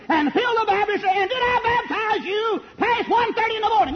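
high shouted question; preacher's shout. Retold or imitated. Preacher retelling J. Frank Norris, shouting high. Climax of retold story.

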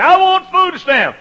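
high, loud, slow haughty demand; a rich man in a tuxedo making a hammed-up demand; mock demanding rich man. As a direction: play the pompous rich man barking his claim.

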